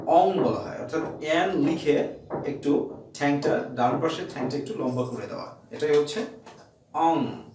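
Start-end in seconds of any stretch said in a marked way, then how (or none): none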